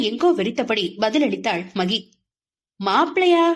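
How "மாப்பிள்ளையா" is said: high, mock-astonished question; playing dumb, pitched up; feigned surprise. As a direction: pretend you didn't get it. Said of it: "புரிந்தும் புரியாதது போல் கேட்டான்".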